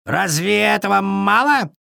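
high raven voice; indignant raven. A narrator's reading. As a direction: challenge them in a high squawk. Raven argues for its find.

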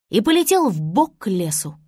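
ordinary delivery, nothing marked

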